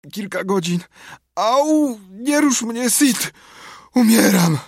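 high wail of feigned agony; theatrical moaning. A narrator's reading. Tomek faking a deathbed for Sid, wailing in pain. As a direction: groan and wail as if dying. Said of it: "Nie jęcz tak".

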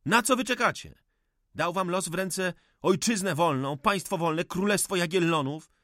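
high, ringing reproach; impassioned rebuke. Acted. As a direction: shake the young man with the charge.

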